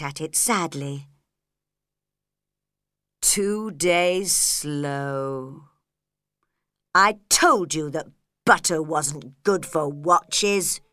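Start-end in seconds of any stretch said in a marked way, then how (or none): 3.2-10.8 slow, low, grumbling Hatter; angry. A narrator's reading. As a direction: drag it out crossly, low and slow. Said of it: "he said angrily to the March Hare".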